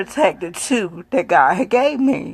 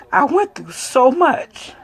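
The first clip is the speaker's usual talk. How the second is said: fighting back real tears as the voice cracks upward; near tears; 7 semitones higher than usual. Real, not acted. Mother recalling her children's deaths, voice breaking into tears. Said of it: "I'm gonna try not to cry, but I know I am".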